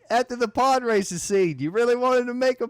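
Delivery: high, half-laughing Aussie voice; hammy Australian teasing; mock Australian accent. Teasing his friend as Aussie Jake, mock Australian. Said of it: "my Australian accent impression".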